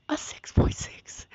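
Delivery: whispered in disbelief. Real, not acted. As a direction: breathe it out, voiceless and stunned.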